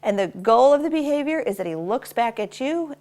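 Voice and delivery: normal talking voice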